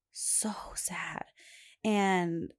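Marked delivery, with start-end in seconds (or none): 0.1-1.2 whisper. Shown as a demonstration. Mom echoing her daughter's sadness, whispered. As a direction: repeat so sad in a soft unvoiced whisper.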